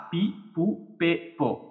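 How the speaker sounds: "পি, পু, পে, পো" said softly with a crisp p; p syllables. Shown as a demonstration. Teacher reads the handakuten syllables aloud for students. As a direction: list "পি" through "পো" quietly, one by one. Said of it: "উচ্চারণ হয়ে যাবে কি পা".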